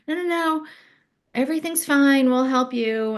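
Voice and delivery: high, brisk reassurance; reassuring family. Retold or imitated. Skier family reassuring nervous in-law, high brisk. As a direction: wave off her worry brightly. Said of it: "And I'm like".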